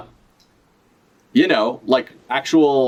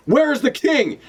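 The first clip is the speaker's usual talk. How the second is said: character voice; 12 semitones higher, slower than usual. Acted. Voices a manga character's line.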